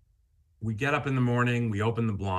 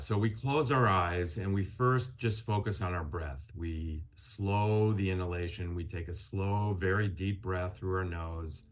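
guided meditation; 4 semitones lower than usual. Acted. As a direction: guide them gently into calm breathing. Leads a gain meditation.

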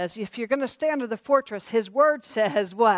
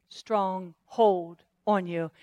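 usual speaking voice

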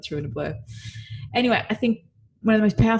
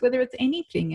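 ordinary talk

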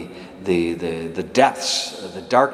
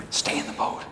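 breathed softly, pressing; whispered plea; 6 dB quieter than usual. Real, not acted. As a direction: hush it like a secret plea. Closing exhortation, whispered.

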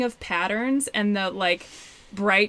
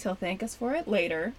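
usual speaking voice

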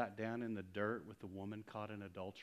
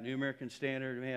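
ordinary talk, nothing marked

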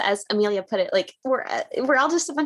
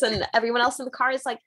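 ordinary talk

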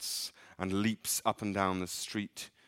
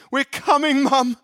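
put-on high, loud children's shout; excited call; 14 dB louder than usual. Boys shouting to their mother, much higher and louder. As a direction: yell down the stairs with joy.

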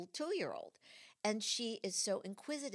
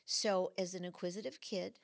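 ordinary delivery

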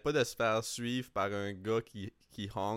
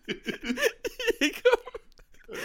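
laughing; 5 dB louder, much slower than usual. Real, not acted. Laughing fit mid-retelling.

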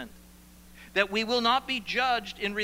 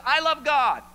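mock insistence; 9 semitones higher, 10 dB louder than usual. Voicing a hypocrite's claim.

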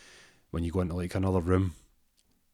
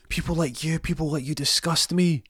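high put-on villain; 9 semitones higher than usual. [name] voiced taunting Rory.

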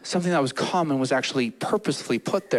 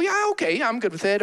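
pitched-up, offhand "yeah, okay"; high shrugging dismissal; 6 semitones higher, much faster than usual. Acted. Listener shrugging off their talent in a high offhand voice. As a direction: brush off your own gift lightly.

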